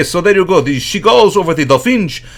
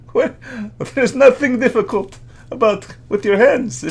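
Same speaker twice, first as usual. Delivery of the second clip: laughing scoff; 6 semitones higher, 3 dB quieter than usual. Acted. Scoffs at American football.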